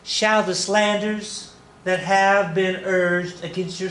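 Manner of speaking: high, slow attempt at a Texas accent; badly faked Texas lady, drawn out high; failed Texas accent. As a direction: try a Texas accent and miss, stretching it slowly. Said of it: "that's not Texas accent".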